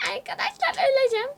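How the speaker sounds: high fast panicked yelp; panicked shriek. Real, not acted. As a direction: shriek it in fright. About to fall in game.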